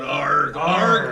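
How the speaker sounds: loud, full-bodied "Arg!"; big pirate yell. Shown as a demonstration. Instructor coaxing a big yell from the patient, pirate yell. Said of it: "Let me hear a big yell".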